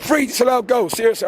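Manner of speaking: high-pitched, outraged fan rant; real football-fan indignation; indignant outburst. Real, not acted. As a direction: repeat it in disbelief, pitch jumping up. Fan ranting about disallowed goals.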